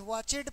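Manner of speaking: वॉचेड in two syllables, with a full "-ed"; common mispronunciation. Shown as a demonstration. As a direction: sound out the extra vowel in वॉचेड. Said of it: "कई लोग वॉचेड पढ़ते हैं". (वॉचेड, 'एड' पूरा बोलकर)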